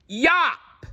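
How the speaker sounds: high, sharp single shout; shouted yop. A narrator's reading. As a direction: let one small kid's yell ring out. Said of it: "he shouted out".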